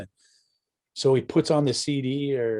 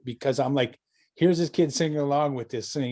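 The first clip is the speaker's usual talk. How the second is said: ordinary talk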